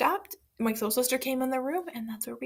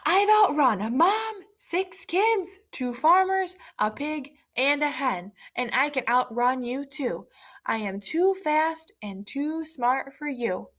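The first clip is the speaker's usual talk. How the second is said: yelling; 4 semitones higher, slower than usual. Acted. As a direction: yell it so the fox can hear. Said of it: "The pancake stopped running and yelled".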